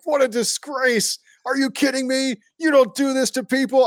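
hammed-up disgusted play-by-play man; mock scandalized TV announcer; Joe Buck. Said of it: "I wouldn't be going Joe Buck and being like".

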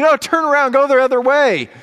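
loud, high-pitched sideline shout; screaming parent. Retold or imitated. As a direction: yell like a frantic soccer parent. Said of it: "one of those parents just screaming".

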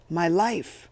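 tight, loud seethe; seething. A narrator's reading. Eddie seething at the captain who shot him, loud and bitter. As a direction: hurl each word at the captain. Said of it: "Eddie seethed".

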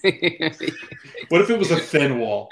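slow, teasing laugh through words. Real, not acted. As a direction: laugh while asking.

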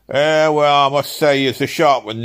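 high, loud, put-on admiration; counterfeit admiration. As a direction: lay on fake admiration for a customer. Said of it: "with a counterfeit admiration".

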